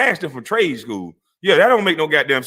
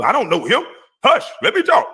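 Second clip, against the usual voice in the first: mock yell; 8 semitones higher than usual. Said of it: "You were yelling and shouting".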